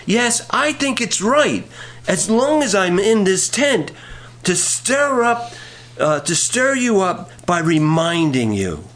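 high, slow proclaimed verse; emphatic scripture reading. Acted. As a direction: proclaim the verse with drawn-out key words. Reads scripture aloud to congregation.

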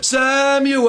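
high, long-drawn call. Acted. God calls to sleeping Samuel in the night, long high call. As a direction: stretch the name out on a high, held note. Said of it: "I know what".

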